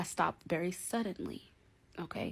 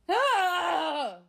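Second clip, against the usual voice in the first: loud, high drawn-out scream; exasperated scream; 10 semitones higher, 9 dB louder than usual. Acted. Woman who can't escape her caller, exasperated scream. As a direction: scream out in mock despair.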